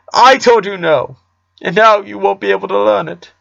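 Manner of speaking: a father pouring out his money worries; distressed father. Acted. Father laments.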